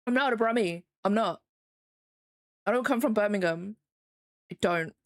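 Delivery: ordinary delivery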